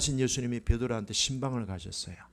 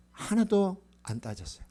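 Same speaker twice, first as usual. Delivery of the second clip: usual speaking voice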